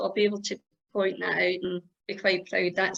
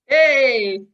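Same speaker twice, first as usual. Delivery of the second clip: loud, higher-pitched cheer; 5 semitones higher, 8 dB louder than usual. Real, not acted. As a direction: call it out like a little celebration. Cheers as screen share works.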